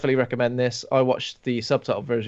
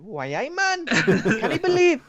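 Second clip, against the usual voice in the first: high, hammed-up Geordie accent with a laugh breaking through; 13 semitones higher than usual. Joking about dubbing a film, mock Geordie accent. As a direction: ham up a Geordie dub voice. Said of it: "I want bloody Geordie, Geordie accent".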